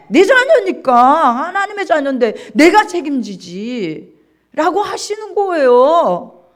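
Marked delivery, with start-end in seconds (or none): 0.1-4.8 louder, slower fatherly declaration; God speaking loudly. Acted. Preacher voicing God to the congregation, loud and slow. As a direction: proclaim it as God claiming his child.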